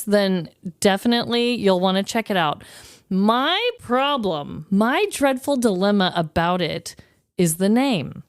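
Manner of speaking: ordinary talk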